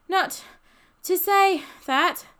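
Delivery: slow, high, broken by breaths; puffing. A narrator's reading. Ben reproving Freddie uphill, puffing. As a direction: heave each bit out breathlessly. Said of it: "puffed Ben".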